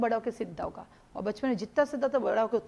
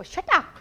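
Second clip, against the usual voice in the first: slap sound; 7 semitones higher, 6 dB louder, much slower than usual. Retold or imitated.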